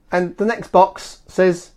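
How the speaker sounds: usual speaking voice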